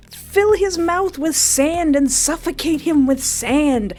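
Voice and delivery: high urgent yelling; frustrated yelling. Real, not acted. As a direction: yell at the screen, exasperated. Yelling advice at the game.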